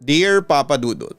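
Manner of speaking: usual speaking voice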